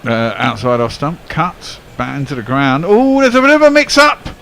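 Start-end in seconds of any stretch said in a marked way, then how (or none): 2.9-4.3 high, loud sudden alarm; excited commentary. Real, not acted. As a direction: call the mix-up as it happens. Live commentary on a run-out mix-up.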